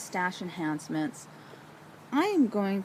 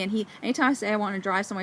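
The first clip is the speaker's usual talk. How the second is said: normal talking voice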